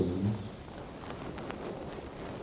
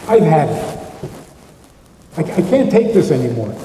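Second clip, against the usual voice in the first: louder, fed-up quote of a spouse; exasperated outburst; 5 dB louder than usual. Acted. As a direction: act out being at the end of your rope. Example of careless outburst in a quarrel.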